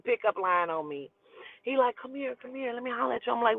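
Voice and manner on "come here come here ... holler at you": hushed come-on. Retold or imitated. Older guy to girl in stairwell, soft come-on. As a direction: murmur it like a smooth older guy. Guy calling her over.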